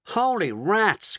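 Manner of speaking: slow, high astonished cry; startled cry. A narrator's reading. Shanks spots the strange craft, astonished slow cry. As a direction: drag it out in shock. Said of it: "cried Shanks".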